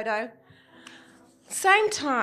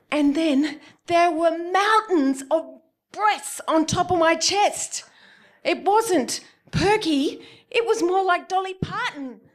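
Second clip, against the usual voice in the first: amazed telling; 7 semitones higher, 4 dB louder, slower than usual. Real, not acted. Describing shock at huge breasts.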